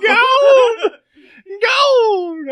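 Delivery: high, loud cry of gold; excited shout. Acted. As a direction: scream it like you just struck gold. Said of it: "You scream gold like you found some gold".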